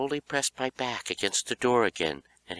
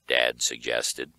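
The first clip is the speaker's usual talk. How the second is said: ordinary delivery, nothing marked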